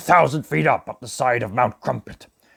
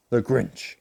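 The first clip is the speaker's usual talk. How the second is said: usual speaking voice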